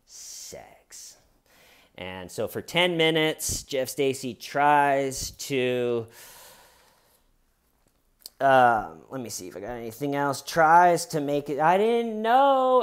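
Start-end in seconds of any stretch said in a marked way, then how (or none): 0.1-1.2 breathy flat repeat barely voiced; whispered deadpan. Detective to Jeff Stacy, whispered deadpan repeat. 11.6-12.8 much higher, slow, whining; high whiny plea. Retold or imitated. Jeff Stacy to the detective, high whiny plea.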